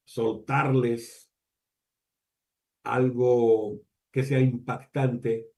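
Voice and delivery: very slow, words spaced out with long pauses; demonstrated dramatic pause. Shown as a demonstration. Speaker showing teachers a strategic pause, slowed and spaced. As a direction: drop the line slowly, then hold the silence. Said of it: "me quedo callado cuatro segundos".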